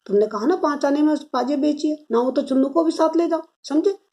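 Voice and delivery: high-pitched, insistent reminder ending on a questioning rise; insistent parent. A narrator's reading. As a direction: press the child to obey, voice lifted. Father presses his son with instructions.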